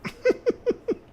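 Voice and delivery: short high laugh; quick high burst of laughter; relieved laugh. Real, not acted. Laughing after finding the fault.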